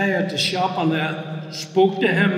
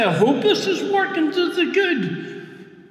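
high, slow, lightly acted uncertainty; doubtful hoping; 9 semitones higher than usual. Preacher admitting his doubt to the congregation, doubtful hoping. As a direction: voice a wishful "hope", pitched well up. Voices own doubt against Paul's certainty.

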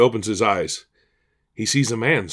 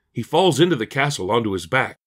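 ordinary delivery

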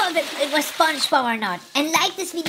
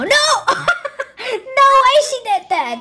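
high, loud, incredulous outburst; amazed reaction, pitched up; shocked disbelief; 5 semitones higher, 4 dB louder than usual. Real, not acted. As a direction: exclaim it high, unable to believe it. Shocked reaction to video clip.

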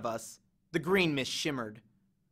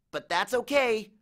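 trembling; 6 semitones higher than usual. Acted. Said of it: "trembling next to me".

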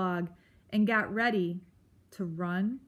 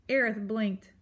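ordinary delivery, nothing marked

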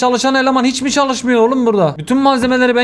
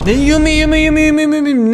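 higher, silly mimicking of gibberish; mocking babble; 7 semitones higher than usual. Gamer mocking a customer's gibberish, silly high mimicry. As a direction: parrot the nonsense sound mockingly.

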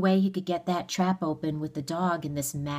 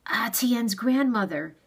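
higher, slow, insistent demand; raised-pitch, deliberate demand; 6 semitones higher, 4 dB louder than usual. A narrator's reading. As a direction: demand it with mild urgency. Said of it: "he demanded".

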